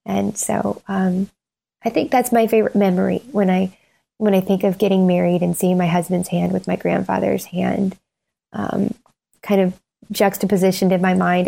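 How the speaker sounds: ordinary talk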